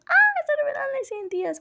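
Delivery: high, loud squeal of pure joy; high-pitched, loud excited squeal; fangirl squealing. Retold or imitated. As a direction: shriek it out like a fan losing it at the big reveal. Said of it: "yo chillando como loca".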